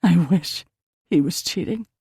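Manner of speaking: slurred and full of emotion; slurred, emotional. Acted. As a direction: let the words blur with grief. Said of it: "Her voice slurred, full of emotion".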